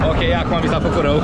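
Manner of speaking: normal talking voice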